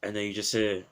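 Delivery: normal talking voice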